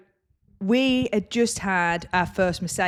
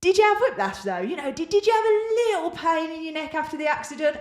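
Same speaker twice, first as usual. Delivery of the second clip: high, wheedling caller voice, coaxing an injury claim; pushy caller; 8 semitones higher, faster than usual. Retold or imitated. Claims caller fishing for a whiplash admission, high and coaxing. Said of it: "And they were like".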